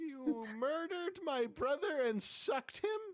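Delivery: high, slow, appalled little demon; squeaky imp, shocked and slow; whiny imp voice. Acted.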